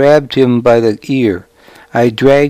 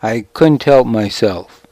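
ordinary delivery, nothing marked

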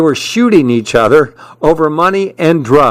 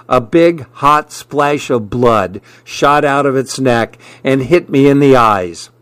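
plain reading voice

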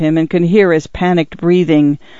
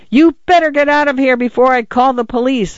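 high, agitated threat without much added volume; shrill yell; 7 semitones higher than usual. A narrator's reading. Arthur threatens the man with the police, shrill yell. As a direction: warn them off in a shrill, strained voice. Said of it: "Arthur yelled. His voice was shrill".

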